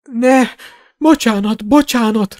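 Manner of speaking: high-pitched, frightened pleading; begging for life. A narrator's reading. Borgin begging the wizards for his life, pleading. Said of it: "rimánkodott Borgin az életéért".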